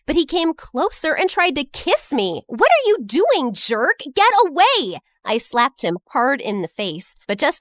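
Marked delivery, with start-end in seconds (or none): 2.5-5.0 high, loud push-off; angry shout. Acted. Narrator fends off the guy's kiss, shouting. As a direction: shove him away with your voice.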